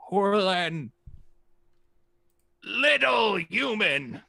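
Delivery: high, slow dragon voice; a dragon pointing out the little human; dragon naming someone. Acted. Dragon identifying the human, high slow voice.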